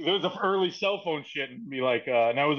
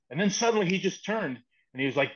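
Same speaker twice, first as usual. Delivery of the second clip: ordinary delivery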